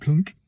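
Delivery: high, loud clunk; imitated rock plop. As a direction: drop the word like a rock hitting water. Imitating rock dropping in creek.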